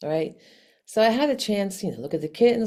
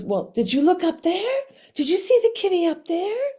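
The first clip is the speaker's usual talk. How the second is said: high, loud, rising questions; bright-eyed retold excitement, voice lifted high; eager questioning; 11 semitones higher, 5 dB louder than usual. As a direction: pitch it up like an excited helper. Acting out worker's eager question.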